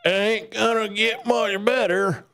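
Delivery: high mock yokel grumbling; put-on yokel voice. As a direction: lay on a silly yokel voice for "ain't gonna". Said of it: "I don't know why I'm talking like this".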